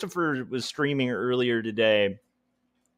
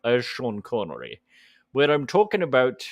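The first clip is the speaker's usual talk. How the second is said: put-on Sean Connery accent. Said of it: "See what I mean? It kinda starts already".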